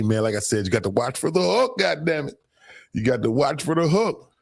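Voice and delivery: normal talking voice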